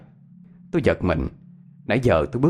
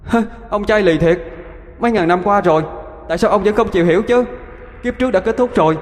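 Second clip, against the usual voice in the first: taunting water ghost; 10 semitones higher, 3 dB louder, faster than usual. Acted. Water ghost confronts the earth god.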